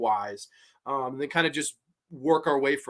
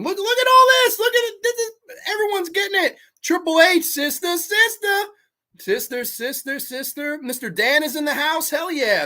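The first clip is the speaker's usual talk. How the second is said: excited; 11 semitones higher, 4 dB louder than usual. Real, not acted. Chat answers flood in.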